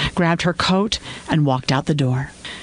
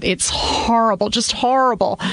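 upset; 7 semitones higher than usual. Acted. Upset wife on phone.

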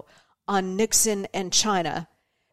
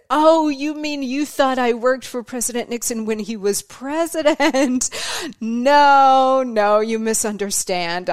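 mock incredulous protest; 4 semitones higher, 5 dB louder than usual. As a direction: protest the misunderstanding with playful indignation. Retold shocked correction after the punchline.

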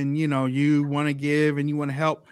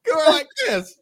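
stifled giggles; 7 semitones higher than usual. Real, not acted. Laughing through the story.

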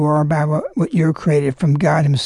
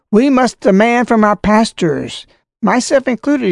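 formal letter reading; 4 semitones higher than usual. Acted. Reads bishop's letter aloud.

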